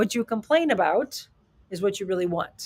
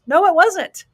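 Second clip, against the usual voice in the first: sharp, higher, louder no; firm retort; 9 semitones higher, 8 dB louder, slower than usual. Real, not acted. Answering a friend's weak apology with a loud, high retort. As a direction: shut the excuse down hard.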